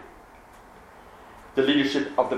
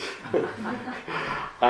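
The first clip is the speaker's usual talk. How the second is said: breathless laugh; 10 semitones higher, 6 dB quieter than usual. Real, not acted. Laughs before doing an accent.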